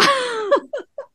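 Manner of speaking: a high, light burst of laughter; real laughter, high and breathy; high giggle. Real, not acted. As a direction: laugh out at the absurdity. Laughing at the judge.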